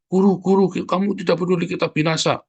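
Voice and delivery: high, loud frantic plea; panicked cry. Retold or imitated. Disciples shouting at sleeping Jesus in panic. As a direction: wake the master in a panic.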